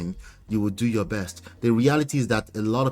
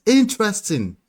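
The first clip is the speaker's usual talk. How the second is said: high, louder exclamation; intrigued surprise; 9 semitones higher, 5 dB louder than usual. Real, not acted. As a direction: react high and loud to the poll. Reacting to surprising poll results.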